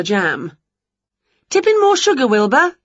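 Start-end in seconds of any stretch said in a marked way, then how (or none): 1.5-2.8 high, loud instruction; brisk, high witch command; bossy witch. A narrator's reading. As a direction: call the order across the kitchen. Winnie orders while cooking.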